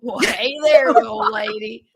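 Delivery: tip your hat and greet her like a sleazy cowboy; cheesy cowboy voice. Pretend. Friend joining the water park joke with a mock cowboy greeting. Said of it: "that's not children centric".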